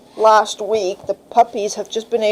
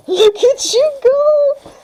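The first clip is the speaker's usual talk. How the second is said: very high, slow, laughing coo at the puppy; squealing with delight at a puppy; delighted laughing praise; much slower than usual. Real, not acted. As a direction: gush over the pup through a laugh.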